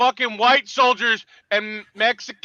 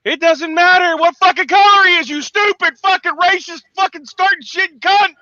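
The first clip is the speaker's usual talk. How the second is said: higher, louder screaming insult; furious shout; 8 semitones higher, 4 dB louder than usual. Real, not acted. Veteran cursing the vice president, furious shout. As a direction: spit it out at full rage. Peak of rant.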